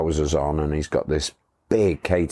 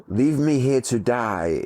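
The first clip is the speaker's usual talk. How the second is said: higher, slow mock-tragic plea; dramatic dying plea; 6 semitones higher, slower than usual. Exhausted guide lying on the floor, mock dramatic plea. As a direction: ham up a dying man's last words. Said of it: "He looks at me and he goes".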